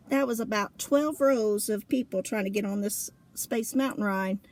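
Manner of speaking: usual speaking voice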